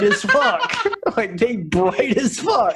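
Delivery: half shouting through laughter; laughing. Real, not acted. Joking with cohost about the cop's high beams, laughing hard.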